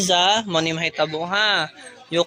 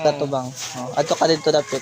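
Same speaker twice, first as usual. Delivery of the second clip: usual speaking voice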